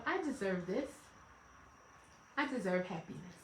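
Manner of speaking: soft, low self-affirmation; quiet self-reminder. Acted. Wife reminding herself she deserves happiness, quiet. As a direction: say it softly, as if to yourself. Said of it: "I have to remind myself".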